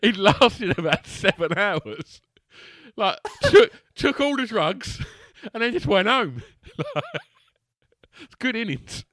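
speaking through loud, high laughter; can barely get the words out for laughing. Real, not acted. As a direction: friend telling the stag story, cracking up.

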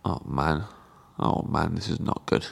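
quiet, low mock despair; mock dismay. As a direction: sink into fake gloom. Mock despair at no juice shot.

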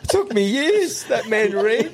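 high, laughing retelling; laughing repeat. Retold or imitated. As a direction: repeat it cracking up, pitch way up. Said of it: "the old boy was shattered".

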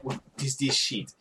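put-on French accent; mock French accent. Thief looking over the stolen phone, mock French accent.

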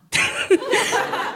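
high-pitched squeal laugh. Real, not acted. Laughs at his answer.

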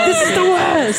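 high whiny complaint. Retold or imitated. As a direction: whine about it. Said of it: "I'm like".

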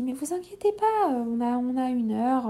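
high, light reassuring voice; a hint of a breezy midwife calming her; imitating the midwife. Midwife reassuring the patient there is plenty of time, high and breezy.

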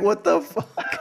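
aghast reaction to a photo of an infected foot; stunned disbelief. Retold or imitated. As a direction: stretch it out high in disbelief. Sees friend's infected foot photo.

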